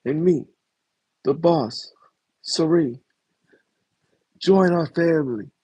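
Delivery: high, put-on mob boss pitch; mafia boss Sari. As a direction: recruit her like a Godfather making an offer. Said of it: "be like".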